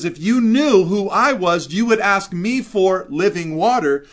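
high and loud, driving each word home; loud proclamation. Acted. Preacher booming Jesus' words to the congregation, loud. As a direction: preach it out at full force.

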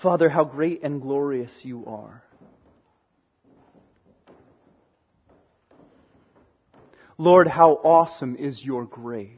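closing prayer. Real, not acted. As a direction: pray quietly before God. Pastor leads closing prayer.

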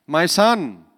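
put-on mild Anglo-Indian accent for mum; a hint of an Anglo-Indian mum's lilt. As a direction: lightly voice an Anglo-Indian mother. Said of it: "She's Anglo-Indian, right?".

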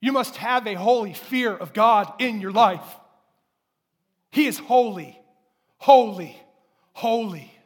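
loud, rising preaching building to a cry on holy; preaching at full force. Acted. Pastor urging holy fear of God, full-force preaching. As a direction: thunder out each holy.

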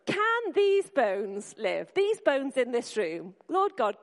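loud slow prayer. Shown as a demonstration. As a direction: call it out slowly so everyone joins in. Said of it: "Go on, have a go".

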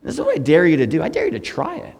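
normal talking voice

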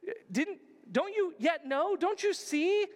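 high, loud mock Jesus scolding his disciples; Jesus chiding. Jesus scolding the frightened disciples, high and loud. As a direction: chide them like an exasperated teacher. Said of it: "he's gonna say".

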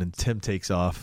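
ordinary talk

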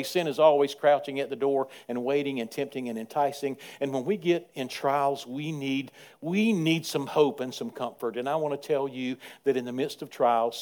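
ordinary delivery

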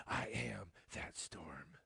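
slow, low, unvoiced whisper imitating a warrior meme; mock dramatic whisper. Warrior meme answering Satan's storm warning, mock whisper. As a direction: whisper it back with mock menace, drawn out. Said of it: "and I whispered back".

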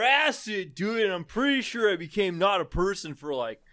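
stoner surfer bro. Pretend. Bro recounting acid with bud Corey, mock stoner voice. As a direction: spin it out like a blissed-out surfer dude.

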